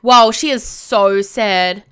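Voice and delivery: ordinary delivery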